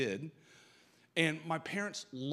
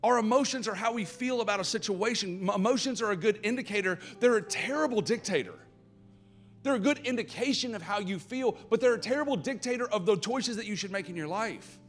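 higher and louder, driving preacher's cry; preaching at full force; 9 semitones higher, 8 dB louder than usual. Acted. Pastor preaching at full force to the congregation about emotions. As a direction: preach it hard and build the fire.

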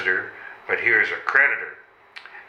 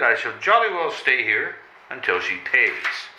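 usual speaking voice

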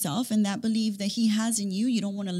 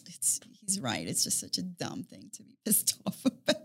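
retold laughing admission; 4 semitones lower, 7 dB quieter than usual. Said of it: "I'm laughing. And I'm like".

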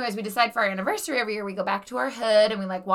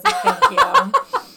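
laughing; 10 dB louder, much slower than usual. Real, not acted. Laughing disbelief at story.

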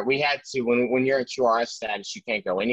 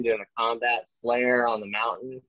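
normal talking voice

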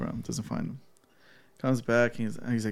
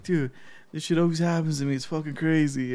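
higher, hurried imitation of an excited friend; excited retelling; 5 semitones higher than usual. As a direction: act out a buddy calling, all worked up. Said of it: "He's all excited and shit".

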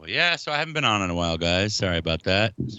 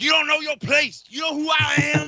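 high-pitched mock outburst; mock an indignant diva blowing up; hammed-up indignant shouting; retold angry snap; 5 dB louder than usual. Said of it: "He snapped at me".

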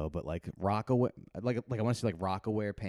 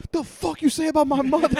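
much higher, loud mock fury; delayed outrage; 11 dB louder than usual. Student finally getting the joke, loud mock outrage. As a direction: explode like the insult just landed. Kid finally reacts, angry.

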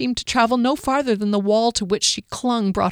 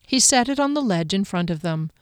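usual speaking voice

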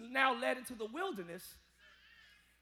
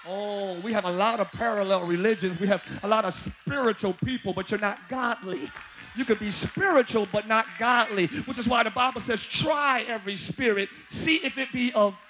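loud preaching voice, pressing each point; full-force sermon delivery; 3 semitones lower, 10 dB louder than usual. Acted. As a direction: preach it hard to the congregation.

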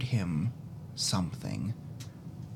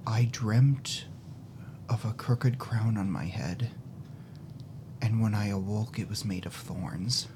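dramatic journal reading; 4 dB quieter than usual. A narrator's reading. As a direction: read it like a cursed diary. GM reads the journal's last line.

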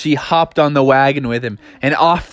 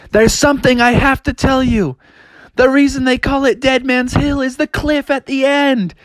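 high cub confession; 10 semitones higher than usual. Acted. Bubby confesses about cliff.

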